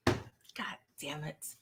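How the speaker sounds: slow breathy whisper of defeat; whispered curse. Real, not acted. Reacting to the sad scene, a slow whispered curse. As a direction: let it out under your breath, slowly.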